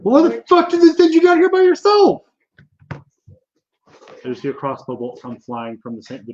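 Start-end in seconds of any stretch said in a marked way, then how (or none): 0.0-2.2 very high, loud squeaky goblin disbelief; incredulous goblin. Acted. Goblin scolding the adventurer sent down alone, high outraged.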